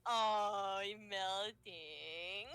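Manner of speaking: put-on slow, drawn-out baby talk; cooing. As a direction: coo over a tiny creature.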